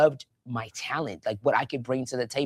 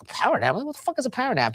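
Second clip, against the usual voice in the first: baffled; 10 semitones higher, faster than usual. Retold or imitated. Acted baffled reply to advice.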